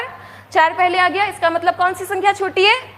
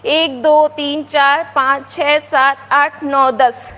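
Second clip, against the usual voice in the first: put-on counting aloud for kids; 3 semitones lower, much slower than usual. Shown as a demonstration. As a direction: count each number out clearly. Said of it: "आती है ना?". (बच्चों के लिए ज़ोर से गिनती)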